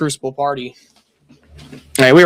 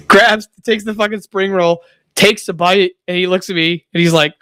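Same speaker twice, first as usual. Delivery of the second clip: normal talking voice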